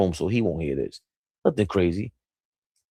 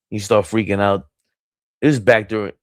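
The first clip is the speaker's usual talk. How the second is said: ordinary delivery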